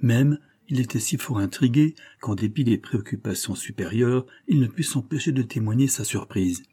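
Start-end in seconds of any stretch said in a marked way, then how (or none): none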